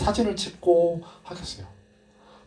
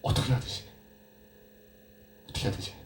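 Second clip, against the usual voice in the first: soft anxious muttering to himself; hushed, fretful self-talk; worried murmur; 3 semitones lower, 9 dB quieter, faster than usual. Retold or imitated. Peter wondering what to do, soft anxious murmur.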